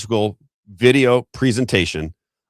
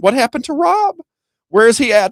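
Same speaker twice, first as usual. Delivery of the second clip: imitating puzzled listeners in a much higher, louder voice; mock fans asking where he went, pitched way up; 5 dB louder than usual. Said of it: "people in Missouri that went".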